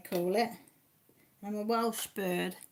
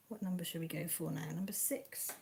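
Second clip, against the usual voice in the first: normal talking voice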